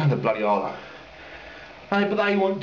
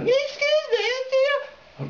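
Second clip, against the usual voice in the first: very high, very slow put-on woman's voice; mock posh lady calling after a cyclist; much slower than usual. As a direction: stretch out a high, prissy lady's "excuse me". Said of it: "the woman goes".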